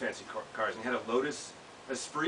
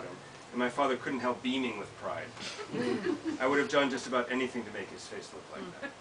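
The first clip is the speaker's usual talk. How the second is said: measured reading of a written memoir, a touch livelier than chat; essay reading; 3 semitones higher, 4 dB louder than usual. Acted. Author reading her memoir to an audience, measured reading voice. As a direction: read your essay to the room, steady and deliberate.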